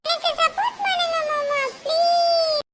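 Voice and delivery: put-on child wailing please to uncle; crying plea. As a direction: bawl for uncle's support. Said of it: "அழறத நிறுத்து".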